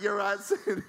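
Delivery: high, loud laughing reply; laughing agreement. Retold or imitated. Preacher laughs along agreeing with Sandy, laughing. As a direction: laugh it out, giving in.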